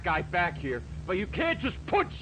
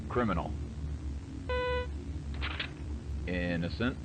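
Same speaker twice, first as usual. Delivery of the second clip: robotic judge. Acted. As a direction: pronounce each verdict like a cold machine. Deep robotic judge voice in game.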